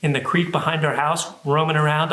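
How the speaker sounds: ordinary delivery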